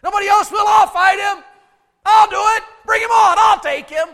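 high, loud, punchy battle bravado; volunteer at the top of your voice; eager teenage boast. Acted. Teenage David volunteering to fight the giant, eager shouting. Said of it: "He's a teenager".

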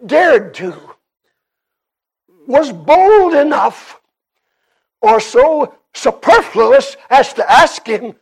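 ringing pulpit declaration; emphatic preaching. Acted. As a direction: preach it hard, leaning on key words. Preaches at full force.

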